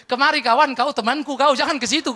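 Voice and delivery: loud, hearty call of Judas, beckoning a friend. Acted. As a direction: wave him over with a loud mock welcome. Judas welcoming a suicide.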